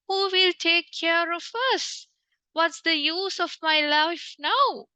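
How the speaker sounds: mock crying lament. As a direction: wail it like fake mourning. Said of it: "asking so many questions and crying".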